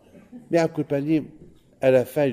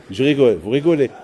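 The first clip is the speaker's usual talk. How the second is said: ordinary talk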